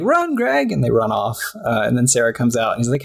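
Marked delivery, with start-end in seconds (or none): 0.0-0.7 high, urgent yelp to flee; high frantic call to run; panicked cry. Retold or imitated. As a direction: call out in sudden alarm, pitched way up. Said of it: "And they're like".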